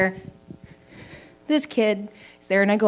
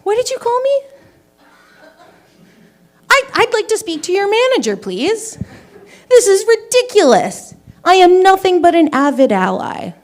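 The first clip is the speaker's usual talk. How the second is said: high, outraged demand for the manager; indignant Karen; 10 semitones higher, 3 dB louder than usual. Retold or imitated. To the shoe store teen, demanding the manager in high outrage. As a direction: play the entitled customer, all offended pitch. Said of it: "I'm just like".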